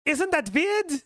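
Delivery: exaggerated mock Dutch accent, very high and drawn out; Goldmember. Imitating a movie character for friends, hammed-up Holland accent. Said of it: "when Mike Myers did that Holland accent".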